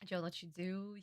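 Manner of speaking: normal talking voice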